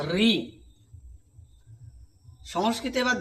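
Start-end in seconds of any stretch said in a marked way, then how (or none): 0.1-0.3 "রি" said as a short crisp ri; short ri sound. Shown as a demonstration. Teacher to class, modelling "রি" short and crisp. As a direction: clip the vowel short in "রি". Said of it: "কিন্তু এর উচ্চারণ হচ্ছে".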